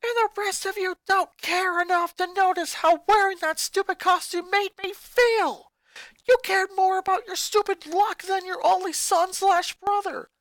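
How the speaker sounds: put-on high, loud furious boy shouting at family; a boy exploding at his mother; angry boy ranting. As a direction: snap back at Mom, furious. Lincoln furious.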